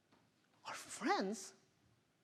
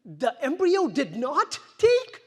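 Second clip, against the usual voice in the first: shocked disbelief; 7 semitones higher, 12 dB louder than usual. Retold or imitated. Shock at the failed embryo.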